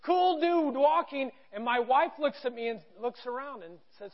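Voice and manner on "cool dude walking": imitated crew calling back, high; higher, slowed mock holler; echoed market call. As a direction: draw it out like a vendor's echo. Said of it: "everybody behind the actual stand goes".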